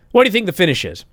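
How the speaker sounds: ordinary delivery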